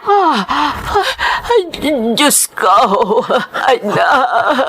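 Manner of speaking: slow, anguished exclamation; shocked cry. Acted. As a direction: gasp at the bad news. Mother hears of hospital news.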